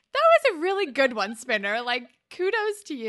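high, loud mock-gushing compliment; gushing praise. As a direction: cheer him on, over the top. Sarcastic praise of Spinner's line.

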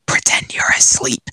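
put-on half-drunk stage whisper; loud drunk whisper. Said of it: "in a very loud, half-drunk voice".

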